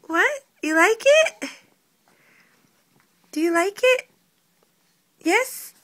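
high, loud, delighted questions. Real, not acted. Mother thrilled the baby likes the mobile, high and excited.

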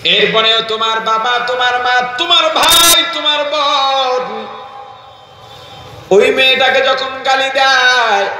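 rising, high sermon chant; melodic exhortation. Real, not acted. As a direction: build up the list of relatives, voice climbing high. Preacher builds accusing chant. (চড়া সুরে অভিযোগের ওয়াজ)

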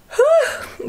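a long, high yawn; big yawn. Real, not acted. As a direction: yawn openly on camera. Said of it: "stop yawning in my vlogs".

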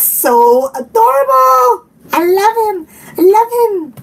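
high cooing over something cute; adoring gush. Real, not acted. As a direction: gush adoringly, pitch rising into a coo. Gushing over Slinky toy.

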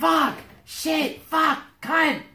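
high yelped curses, loud bursts; pained cursing. Acted. Ivis has just cut his own hand, pained yelped cursing. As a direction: shriek each swear as a separate yelp.

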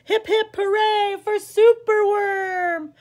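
bright cheer, high and ringing; a happy chant for the hero. Acted. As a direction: cheer him on like the crowd.

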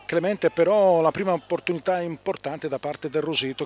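high and loud play-by-play; excited commentary. Real, not acted. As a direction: call the chance with rising excitement. Header and save near goal.

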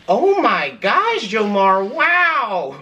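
high, slow impressed gasp; lightly voiced awed girlfriend. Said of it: "she's like".

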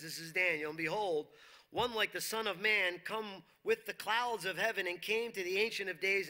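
usual speaking voice

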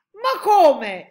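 loud, high outcry of disbelief; astonished exclamation. A narrator's reading. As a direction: cry out in protest, pitch jumping up. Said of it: "esclamarono insieme i due militari". (esclamazione incredula, forte e acuta)

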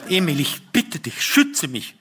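wife's pleading call. A narrator's reading. Wife begging her husband for protection, mildly alarmed plea. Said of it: "rief meine Frau".

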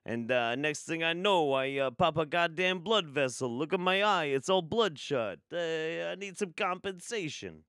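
loud, brash New Jersey plaintiff pleading in court; Jersey accent. Pretend. Streamer as a Jersey plaintiff to the judge, loud Jersey accent. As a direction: play it like a Jersey guy suing his Reiki healer, loud. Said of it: "I don't know why he's from, uh, from Jersey".

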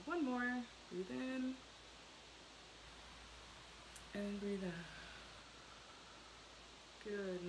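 hushed, soothing spa voice; soft spa whisper. Acted. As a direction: guide them to relax, softly. Leads breathing exercise.

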